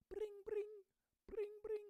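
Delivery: high, trilled ringing noise; high-pitched rolled ring-ring; phone ring imitation.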